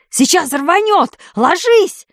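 panicked yell. A narrator's reading. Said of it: "заорал руководитель фирмы".

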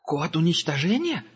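the angel repeats Gerda's words, startled and scared; frightened echo. A narrator's reading. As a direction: echo her words in alarm. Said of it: "испугался ангел".

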